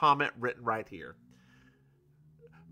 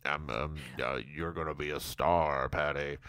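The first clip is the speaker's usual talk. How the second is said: much lower, quieter mock speech voice; Barry's speech; 12 semitones lower, 9 dB quieter than usual. Host voices Barry toasting Patty, low and quiet. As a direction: drop the voice deep and soft for Barry. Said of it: "Barry stands up to make a speech".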